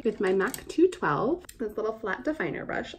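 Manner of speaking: put-on voice. Real, not acted. Showing viewers the little definer brush, in a put-on voice. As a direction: slip into a silly voice, rising in pitch. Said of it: "I don't know why I go into that voice".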